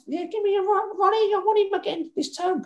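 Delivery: high falsetto impression of the cook; imitating Rita the cook. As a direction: squeak it out like the old cook. Rita voiced again.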